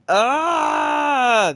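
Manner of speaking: high, very loud scream; real long scream of frustration; frustrated scream. Real, not acted. Player crashing out over a glitch, screaming. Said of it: "the crash out is so real".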